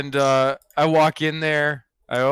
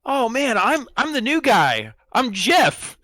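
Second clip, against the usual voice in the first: high-pitched overkeen new guy; eager newbie; 9 semitones higher, slower than usual. Acted. Player introduces himself to gamblers as recruit, high eager newbie. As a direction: pitch it up like a nervous-to-please rookie.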